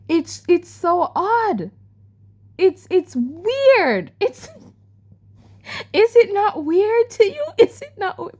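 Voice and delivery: high, loud, drawn-out disbelief; real incredulity. Real, not acted. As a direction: appeal to viewers in exasperated high pitch. Incredulous appeal about the odd book.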